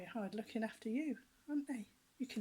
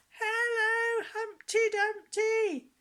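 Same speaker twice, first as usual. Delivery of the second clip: much higher, louder shout, slow at the end; little girl's shout; 13 semitones higher, 11 dB louder, much slower than usual. Retold or imitated. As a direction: call out with all her might. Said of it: "shouted so loud".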